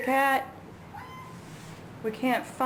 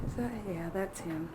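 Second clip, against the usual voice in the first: hushed; 3 semitones lower, 9 dB quieter than usual. Real, not acted. Quiet spotting of bird.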